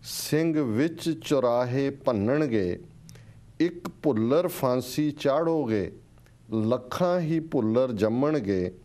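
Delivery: slow, measured verse with swelling, falling line ends; stirring, drawn-out poetry reading; poem recitation. Real, not acted. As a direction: declaim each line deliberately, pausing between phrases. Recites a listener's poem aloud.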